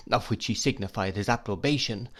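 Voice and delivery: ordinary delivery, nothing marked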